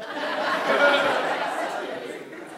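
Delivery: 자기 이야기에 터진 높은 웃음; 숨소리 섞인 높은 웃음; breathy high laugh. Real, not acted. Laughing at his own story.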